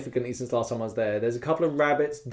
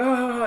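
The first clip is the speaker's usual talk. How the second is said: mock battle cry; 11 semitones higher than usual. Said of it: "And I'm like".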